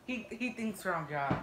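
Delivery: ordinary talk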